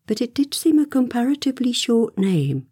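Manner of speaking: ordinary delivery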